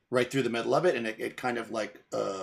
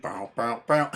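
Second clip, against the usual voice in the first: mock spy theme; 6 dB louder, slower than usual. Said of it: "He's like".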